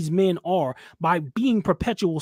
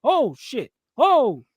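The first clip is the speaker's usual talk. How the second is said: mock panic; 7 semitones higher than usual. Mocking panicked servile men.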